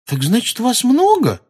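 high, loud disbelieving question; incredulous. Acted. Pupil asking if there are many centaurs, incredulous. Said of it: "недоверчиво спросила она".

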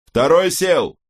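high, loud call over the radio; radio shout. A narrator's reading. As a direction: bark it out like a pilot. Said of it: "донёсся ещё один". (громкий выкрик по рации)

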